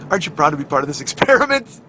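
usual speaking voice

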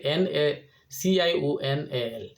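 slow letter by letter N A C I O N A L; spelling out. Shown as a demonstration. Vlogger spelling the Portuguese word for viewers, slow letter by letter. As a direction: spell each letter out slowly, stressing the C. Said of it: "Portuguese ভাষায় লিখবে".

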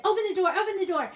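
high, loud and fast; hurrying out with a squirrel in a box; urgent plea. Retold or imitated. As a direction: rush the words out, desperate.

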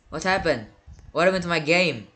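ordinary delivery

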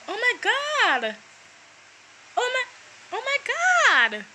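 high-pitched, halting oh-my-God; mock alarm. Caricature of someone during an earthquake, mock alarm. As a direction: gasp it out in staged alarm.

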